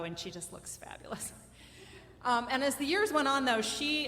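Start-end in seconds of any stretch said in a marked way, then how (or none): 0.0-1.3 a whispered aside on "fabulous". Real, not acted. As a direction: lean in and whisper it like a secret. Whispered aside about her daughter.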